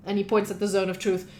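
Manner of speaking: ordinary talk, nothing marked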